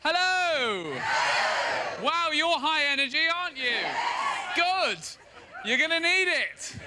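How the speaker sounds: high-pitched, projected host call; calling out to a crowd. Real, not acted. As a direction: call over the cheering audience. Host calls to cheering crowd.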